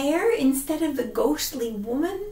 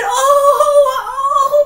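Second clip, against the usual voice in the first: frightened wails; 12 semitones higher, 10 dB louder than usual. Acted. Slipping from tree.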